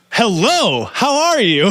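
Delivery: high-pitched, stilted textbook greeting; overly proper. Acted. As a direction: greet a friend with mock formality. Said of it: "Why did he say that so properly?".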